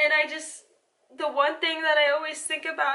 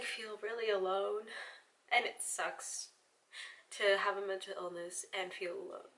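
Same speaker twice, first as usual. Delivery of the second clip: much softer and lower, almost to herself; quiet and low; 7 semitones lower, 12 dB quieter than usual. Real, not acted. Vlogger admits to viewers she feels alone, very soft and low. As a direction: let it sink into a low hush. Confessing loneliness on camera.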